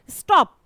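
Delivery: Stop said whole in one quick beat; one single burst. Shown as a demonstration. Teacher demonstrating one syllable, stop in one go. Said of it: "एक बार में बोला".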